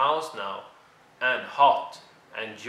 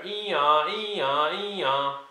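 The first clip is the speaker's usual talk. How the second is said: siren noise; 4 semitones higher, 4 dB louder, much slower than usual. Acted.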